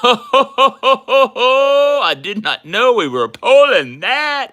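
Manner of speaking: slow, high words through laughter; laughing while talking. Real, not acted. Laughs over the pull.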